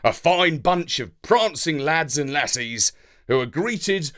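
usual speaking voice

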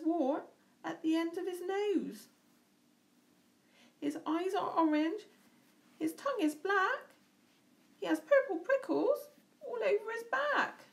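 high, rising panic; terrified mouse. Acted. Mouse meeting the real Gruffalo, rising terror. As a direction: build to a frightened squeal.

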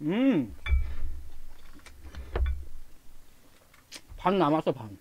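talking while chewing; muffled through food; mouth full. Real, not acted. Seen 2: cheeks puffed, jaw working from bite at -2 throughout labelled words.

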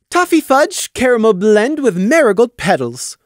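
very high, loud presentation; announced. Acted. Taffy presents her toffee, high and proud. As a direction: show off the sweets proudly. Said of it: "Taffy announced".

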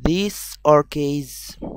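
"those are keys" and "these are keys" drawn out word by word; teacher slowly reads both answer choices; very slow quiz read. Shown as a demonstration. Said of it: "أنهو الأصح؟".

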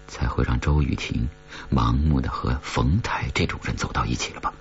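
ordinary delivery, nothing marked

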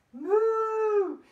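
long, loud high moo; big swooping cow noise. Pretend. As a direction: imitate a cow for children. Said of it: "she makes a noise like this".

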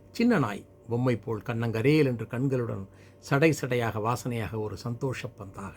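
plain reading voice

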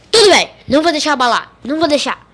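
loud fast pep talk; determined. Real, not acted. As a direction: psych yourself up out loud. Self-pep after bad luck.

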